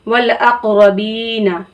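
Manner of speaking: वल अकरबीना with बी stretched long; slow teaching read stretching बी; drawn long vowel. Shown as a demonstration. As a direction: hold the ee of बी in अकरबीना. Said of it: "इसलिए हम बा को क्या करेंगे? खींच कर पढ़ेंगे".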